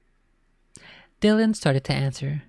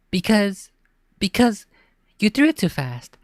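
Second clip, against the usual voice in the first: higher, halting flustered answer; stammering; 6 semitones higher, 3 dB louder than usual. A narrator's reading. Girl cornered by her teasing brother, flustered stammer. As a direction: trip over because twice. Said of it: "Ashley stammered".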